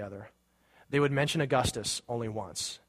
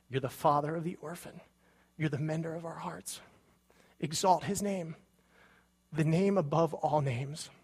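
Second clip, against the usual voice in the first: emotional; 4 semitones higher than usual. Real, not acted. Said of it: "I get really emotional when I hear this song".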